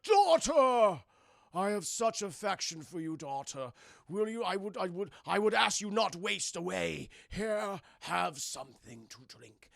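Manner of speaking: mock-regal villain father, booming first word; slow, hammed-up tyrant addressing his daughter; Shao Kahn. Shao Kahn offers his caged daughter a drink, hammed-up villain voice. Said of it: "Shao Kahn comes. He's like".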